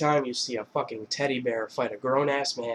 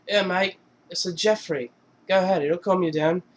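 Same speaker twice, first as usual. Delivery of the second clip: light mock British accent, higher pitched; casual British impression, lightly done; Russell Brand; 6 semitones higher than usual. British rocker offering Jonah Hill a smoke, mock British accent.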